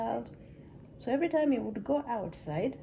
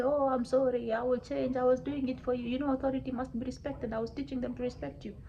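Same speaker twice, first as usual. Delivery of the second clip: apologetic, self-justifying rush at a raised pitch; pleading king; 3 semitones higher than usual. Acted. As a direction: plead your case to a god.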